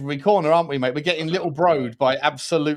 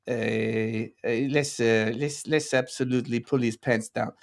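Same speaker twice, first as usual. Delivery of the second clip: put-on a weak, lower Spanish accent for Pep Guardiola; a mock Spanish accent, not quite landing; 6 semitones lower, 4 dB quieter, slower than usual. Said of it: "Spanish accent's harder than you think".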